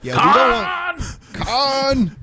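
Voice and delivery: loud, slow mock outcry; shouting. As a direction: belt it out in protest. Shouted joke about Khan.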